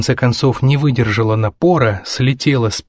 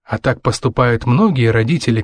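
plain reading voice

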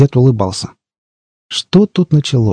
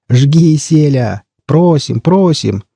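higher, drawn-out crowd urging; cheering on; 4 semitones higher, much slower than usual. A narrator's reading. Stalkers at the tables urge their friend to perform, cheering. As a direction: goad him on like an eager audience.

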